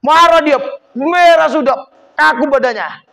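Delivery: a burst of loud, high fury, face going red; mock rage. Preacher showing a man red with anger, loud and high. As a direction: act out the man about to explode.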